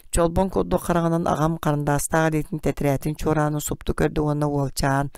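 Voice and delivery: plain reading voice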